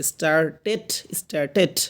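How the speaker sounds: "স্টার্টেড" split as star-tet, clear e in the ending; "স্টার্টেড" demonstrated with a separate, rising -tet; e-vowel ending. Shown as a demonstration. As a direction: say "স্টার্টেড" with the last syllable sounded tet. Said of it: "একার মতো উচ্চারণ করতে হয়".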